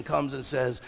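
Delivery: ordinary talk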